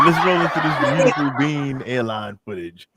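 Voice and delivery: usual speaking voice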